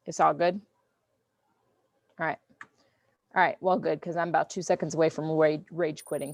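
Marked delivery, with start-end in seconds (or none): none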